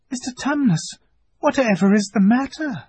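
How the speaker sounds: put-on high worried child's question; a girl alarmed at tears; worried girl Lucy. Lucy asks the crying fawn what is wrong, worried.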